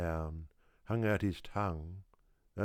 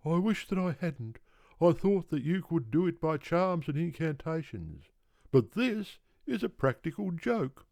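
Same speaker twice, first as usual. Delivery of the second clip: put-on tired old kangaroo, complaining; 10 semitones higher than usual. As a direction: whine out your wrongs like a worn-out old kangaroo.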